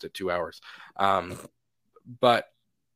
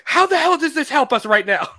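high, loud, laughing exasperated question; 12 semitones higher, 8 dB louder than usual. Retold or imitated. Toby cutting off Tom's Avengers talk, loud exasperated laugh. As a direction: blurt it out fed up, half laughing. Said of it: "Toby goes".

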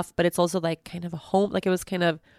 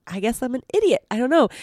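ordinary talk

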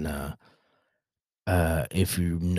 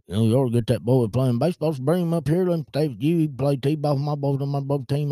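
loud, higher, run-together country mumble; Boomhauer; 5 semitones higher, 4 dB louder than usual. Retold or imitated. Coworker pushes dad to bring son for baseball, Boomhauer impression. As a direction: imitate a fast-talking country guy pushing his point. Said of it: "he sounded like Boomhauer".

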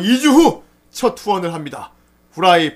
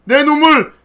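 high, loud yell building to a scream; hammed-up wail demanding it back; mock outraged shout; 12 semitones higher, 9 dB louder, slower than usual. Host acting out a fan wanting tears back, mock outraged shout.

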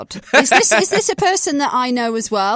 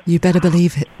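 mock seductive caller; 4 semitones lower, 5 dB louder, faster than usual. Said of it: "like, sexy time you".

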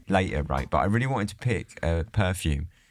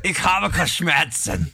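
mock German accent; 9 semitones higher, 3 dB louder, slower than usual. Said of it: "offended every German listener".

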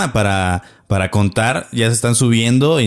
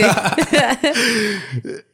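high laugh; 9 semitones higher than usual. Real, not acted. Laughing at ex story.

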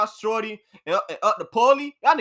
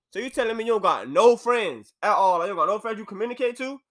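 usual speaking voice

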